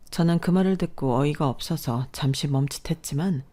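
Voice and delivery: plain reading voice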